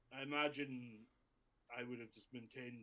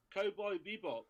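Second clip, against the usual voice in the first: excited; 8 semitones higher, 9 dB louder than usual. Acted. Player jokes loudly.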